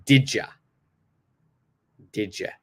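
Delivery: "Did ya" said as didja, short; casual native "Did ya"; blended "did ya". Shown as a demonstration. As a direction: squash "Did ya" together. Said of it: "It becomes".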